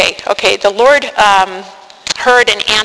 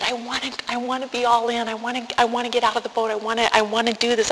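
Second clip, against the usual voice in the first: softer, choked retelling of his sobbing; sobbing plea; 3 semitones higher, 9 dB quieter, faster than usual. Retold or imitated. Husband on the boat, begging to be all in, hushed sobbing. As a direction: let the words fall apart in tears, but hushed. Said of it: "And he was sobbing".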